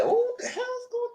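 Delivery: falsetto wail of disbelief; high mock horror; high shocked cry. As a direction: shriek it like a scandalised prude. Hall's shocked reaction acted.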